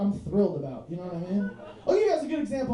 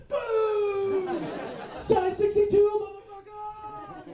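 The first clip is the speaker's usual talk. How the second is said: rock star boast; 10 semitones higher, much slower than usual. Retold or imitated. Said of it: "He's like".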